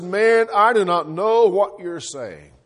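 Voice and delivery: loud, slow and forceful, biting off each word; swearing denial. Acted. Peter cursing at the accuser, loud and slow. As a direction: hammer it out like a cornered man cursing. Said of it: "Peter cursed and swore and said".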